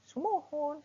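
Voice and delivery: very high, polite little yes; polite Sundanese reply. Retold or imitated. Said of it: "ada orang yang mau pergi kerja". (jawaban sopan Sunda dengan nada sangat tinggi)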